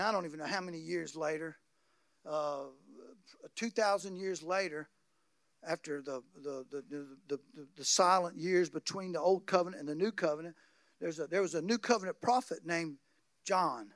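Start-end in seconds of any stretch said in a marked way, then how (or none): none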